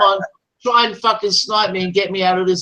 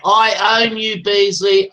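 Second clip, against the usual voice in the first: normal talking voice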